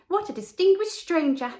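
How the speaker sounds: gossiping little birds impressed; chirruping sparrows. A narrator's reading. Sparrows chirrup to each other about the swallow, high twitter.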